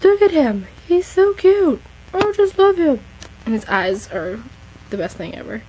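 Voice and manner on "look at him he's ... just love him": gushing coo. Real, not acted. Cooing over her model horse.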